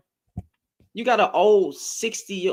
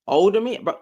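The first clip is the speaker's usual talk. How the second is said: ordinary talk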